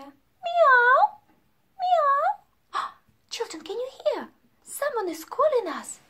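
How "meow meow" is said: high mewing cat call; cat meow. Acted. Teacher meowing like a cat for the children. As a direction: meow like a little kitten.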